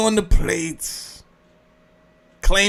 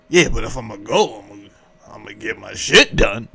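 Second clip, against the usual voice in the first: much lower, fast mock voice; imitating a guy; 9 semitones lower, faster than usual. Mocking someone who shrugs off going to jail, low and fast. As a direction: drop low and rattle it off like the guy.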